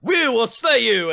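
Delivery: high, loud, slow heroic proclamation; hammy stage paladin. Acted. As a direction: boom it like a play-acting knight. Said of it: "paladin-like armor going".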